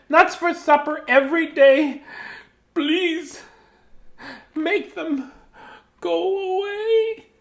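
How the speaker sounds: high squirrel close to tears, slow; whiny squirrel. Acted. Reading to kids as a high, whiny squirrel. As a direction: whine it like a squirrel who's had enough.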